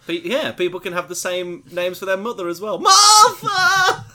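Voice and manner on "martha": sudden hammed-up yell, much higher and louder; mock shout. Co-host parodies a film scene with a mock shout. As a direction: bellow the name like a famous movie moment. Mock cry of a famous name.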